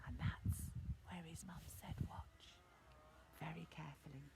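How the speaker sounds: hushed, very soft warning to watch; secretive whisper. Acted. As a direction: whisper it to build suspense. Mum about to reveal the secret.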